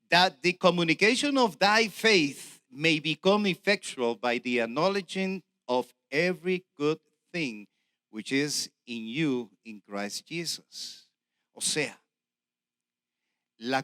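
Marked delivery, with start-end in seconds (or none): 0.1-11.1 English read slowly with a Puerto Rican accent; deliberate verse reading in Puerto Rican-accented English. Real, not acted. Preacher reading a verse to the congregation, Puerto Rican accent. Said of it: "en mi inglés puertorriqueño".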